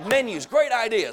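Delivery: high, loud mock enthusiasm; excited waiter. Retelling a waiter's reply to customers, mock excited. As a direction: act out an eager waiter leaping at the idea. Retold waiter seizes on a suggestion.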